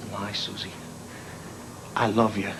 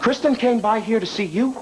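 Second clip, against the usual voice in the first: higher, rising disbelieving question; can't believe what he just heard; incredulous echo; 6 semitones higher than usual. Acted. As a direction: Brian repeats Susie's words, stunned. Brian echoes the news in disbelief.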